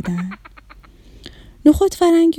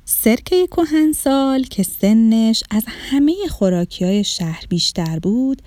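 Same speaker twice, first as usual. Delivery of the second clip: plain reading voice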